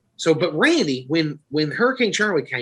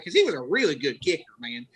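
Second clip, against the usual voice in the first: normal talking voice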